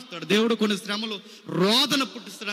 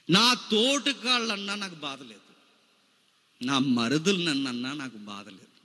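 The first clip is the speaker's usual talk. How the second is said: ordinary talk, nothing marked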